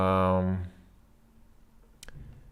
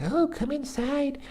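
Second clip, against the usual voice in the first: very high, put-on falsetto woman's voice; mock porn-movie woman. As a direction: squeal a hammed-up come-on in falsetto. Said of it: "the woman is waiting for the postman every day and she's like".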